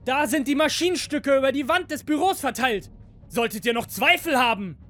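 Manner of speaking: very high, strained angry pitch; angry yelling. A narrator's reading. Second master threatening the android workers, high angry yell. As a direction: keep warning them in a high, angry voice. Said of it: "schreit der zweite Meister wütend".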